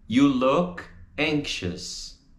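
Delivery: YOU, LOOK, ANXIOUS, slow with gaps; slow, separated model. Shown as a demonstration. Teacher models the vowel in a slow, separated sentence.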